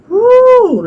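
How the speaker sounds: high, loud cry of pain; pained whoop. Real, not acted. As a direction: let the hurt burst out. Pain while stretching the leg.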